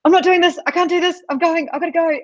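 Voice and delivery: high-pitched mock panic; Fleur. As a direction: play a friend bailing out in comic high-voiced panic. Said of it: "And then Fleur's like".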